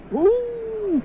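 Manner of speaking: high, long clownish wail; soft, high "uuuh" of pretend weeping; mock crying howl. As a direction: imitate a child's cry as one drawn-out hoot. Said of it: "entonces estaba llorando".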